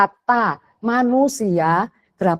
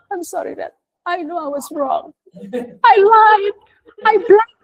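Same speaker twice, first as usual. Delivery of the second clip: put-on higher child voice owning up; apologetic child; 11 semitones higher, slower than usual. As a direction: play the eldest saying sorry fast. Child's quick apology retold. (suara anak tinggi yang minta maaf)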